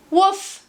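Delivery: high loud friendly woof; dog bark. Acted. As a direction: bark once. Dog's bark.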